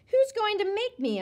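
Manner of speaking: high loud challenge; defiant yell. A narrator's reading. Boy squaring off with Sheila, defiant yell. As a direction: yell it right back at her. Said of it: "I yelled".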